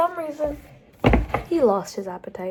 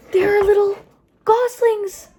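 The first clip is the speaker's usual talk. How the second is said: put-on high, loud, delighted reveal for kids; wide-eyed storytime surprise; excited storytime reveal; 9 semitones higher, 6 dB louder than usual. A narrator's reading. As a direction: make the children go "ooh". Reads a picture book aloud.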